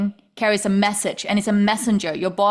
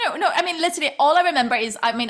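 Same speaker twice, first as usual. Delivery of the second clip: ordinary talk, nothing marked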